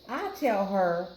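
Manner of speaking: plain reading voice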